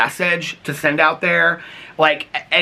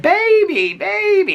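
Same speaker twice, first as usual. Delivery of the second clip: high-pitched whiny girlfriend imitation; mock whiny; 4 dB louder, much slower than usual. Mother's complaint about her son's girlfriend, imitated whiny voice. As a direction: whine it way up high like a needy girlfriend. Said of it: "she's real whiny".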